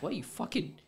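high, outraged squeal of disbelief; the pitch jumps way up in stunned surprise; retelling his shocked reaction to his sister. Retold or imitated. Reacts to sister already knowing.

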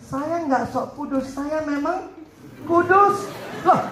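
high, mock-proud retort building to a punched last word; cheeky high-voiced rebuttal; confident comeback. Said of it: "bilangnya apa?".

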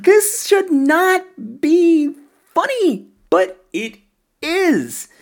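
high-pitched, slow emphatic incredulity, laughter in the voice; amused disbelief. Acted. Film fan voicing a shocked viewer, amused disbelief. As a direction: draw it out high, barely holding back laughter. Amused mock disapproval at a comedy.

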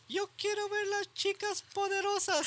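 very high mock whine; imitated crying cousins. Cousin begging for Powerpuff Girls, high mock-crying voice. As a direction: mimic a child wailing for a cartoon. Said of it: "they would cry".

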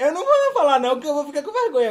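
high, loud and giggly; embarrassed laughing refusal. Real, not acted. Player too ashamed to reveal an answer, giggly refusal.

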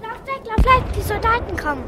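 high, loud alarmed cry; panicked warning. Acted. Frightened woman warning children of soldiers, alarmed cry. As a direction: warn them to run, urgently.

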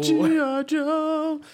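high, slow cartoon quote; G I Joe PSA voice. Quoting G I Joe to a friend in a high cartoon voice. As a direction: mimic the old cartoon PSA line.

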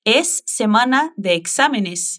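slow, even model sentence; slow drill. Shown as a demonstration. Teacher slowly models the sentence for repetition. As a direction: lay out each word slowly for repeating. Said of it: "Vou te ajudar a repetir".

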